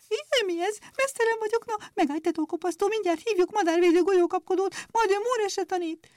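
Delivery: very high, chirpy frightened sparrows; frightened chirping. Acted. Sparrows threatening the scissors, in very high frightened chirps. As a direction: squeak in panic like a plucked sparrow. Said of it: "rémülten csipogtak".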